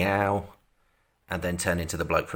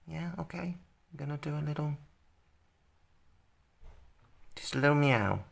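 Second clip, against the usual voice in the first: high playful voice; 8 semitones higher, 6 dB quieter than usual. Pretend. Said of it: "mock voicing Frank as a cat".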